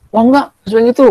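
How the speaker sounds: high, indignant denial; defensive friend. Retold or imitated. Teacher voicing a pupil rejecting a friend's criticism, high and indignant. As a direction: brush the criticism off. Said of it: "kawan kamu kritik".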